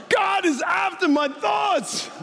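high, slow, drawn-out cry; crazed proclamation. Retold or imitated. Pastor gone mad to his riding partner, high proclaiming cry. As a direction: proclaim it wildly at a startled friend. Re-enacts the outburst.

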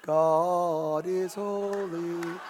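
put-on very slow, low, drawn-out posh English accent; snooty English accent. Preacher mimicking a snooty English churchman in a slow English accent. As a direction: stretch it out in a lofty English accent. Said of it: "we have to do it with an English accent".

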